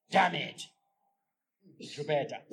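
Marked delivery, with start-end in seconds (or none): 0.1-0.6 "Damn it" voiced and louder; muttered curse aloud. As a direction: spit "Damn it" out. Husband swears again aloud.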